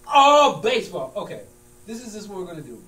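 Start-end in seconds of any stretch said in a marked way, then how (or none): 0.1-1.1 loud, slow angry yell; angry shout. Acted. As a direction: bellow it, fed up. Heated argument over the team.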